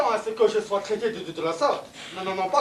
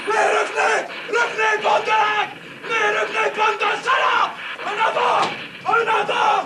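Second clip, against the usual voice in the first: furious shouting; 9 semitones higher than usual. Acted. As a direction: rage at the fleeing men. Officer screams at fleeing troops. (hurlements furieux)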